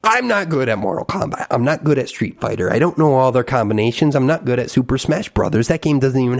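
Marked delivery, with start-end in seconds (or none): none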